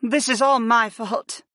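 usual speaking voice

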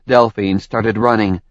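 plain reading voice

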